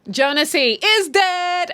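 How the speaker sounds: high, loud, drawn-out celebration; sing-song, stretched-out jubilant cry; gleeful announcement. Real, not acted. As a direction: proclaim it with exaggerated joy. Celebrating a character's death.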